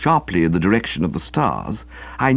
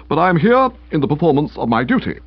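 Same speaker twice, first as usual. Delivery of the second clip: stiff official; 5 semitones higher, faster than usual. Acted. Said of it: "stiffness of manner".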